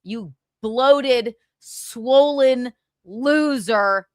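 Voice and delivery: slow, deliberate scolding with long stretched words; drawn-out insult. Real, not acted. Scolding absent Chad on air, slow and scornful. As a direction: dwell on each insulting word.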